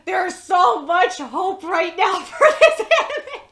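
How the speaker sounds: loud, slow, laughing through the words; excited laughing shout. Real, not acted. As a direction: burst out with hopeful laughter. Excited anime reaction.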